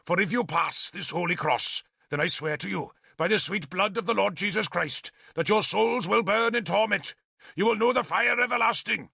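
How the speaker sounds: damn them from the pulpit; heavy curse. A narrator's reading. Bishop delivers a heavy curse.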